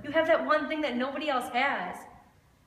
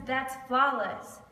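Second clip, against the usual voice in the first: slow and deliberate; much slower than usual. Acted. Refrain after each question.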